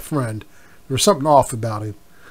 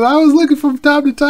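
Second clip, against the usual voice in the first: daughter's high defensive voice; 12 semitones higher, 5 dB louder than usual. Retold or imitated. Daughter's excuse, retold.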